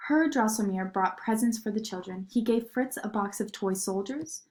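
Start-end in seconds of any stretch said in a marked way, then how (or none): none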